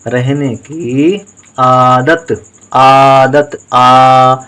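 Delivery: teacher holds आ in आदत to show the long vowel; long held vowel. Shown as a demonstration. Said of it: "देर तक बोल रहे हैं आ को".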